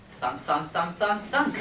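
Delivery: imitated thunking. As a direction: mimic the knock of the loose tire, climbing in pitch. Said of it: "the sound starts again".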